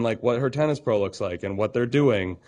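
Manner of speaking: ordinary talk, nothing marked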